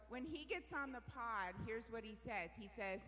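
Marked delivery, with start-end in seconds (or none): none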